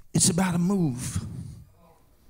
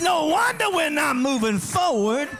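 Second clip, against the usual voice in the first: preaching at full force; 12 semitones higher than usual. Acted. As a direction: whoop it up to the congregation.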